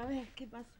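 ordinary delivery

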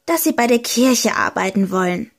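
plain reading voice

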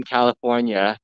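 normal talking voice

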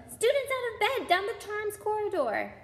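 very high tattling holler; Peeves, bellowing. A narrator's reading. Peeves alerting Filch to students, high bellow. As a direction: shriek the alarm in a piercing high pitch. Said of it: "Peeves bellowed".

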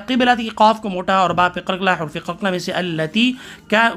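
ordinary talk

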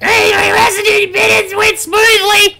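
squeaky cartoon announcer; high shouted goofy read; silly high voice. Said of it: "wouldn't it just be shit if I was just like".

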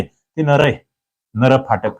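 usual speaking voice

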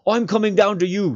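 high, loud determined shout; Sam shouting. Acted. Sam shouting down to Frodo through the rain, determined. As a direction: yell it down into the storm. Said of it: "shouted Sam".

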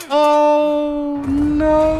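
high, long wailed "oh no"; playful high-pitched wail; drawn-out dismay. Real, not acted. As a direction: stretch both words high and steady. Mishap in the game.

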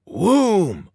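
sudden swelling whoom; explosive whoom. A narrator's reading. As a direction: voice the blast as a big whoosh of fire. Narrator acts explosion noise.

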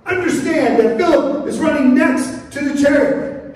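high, insistent preacher's voice; emphatic preaching. Real, not acted. Preacher explains Philip running next to the chariot, emphatic preaching. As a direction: press the picture on the congregation. Stressing the running at full force.

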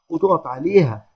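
ordinary delivery

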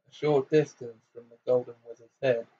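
ordinary delivery, nothing marked

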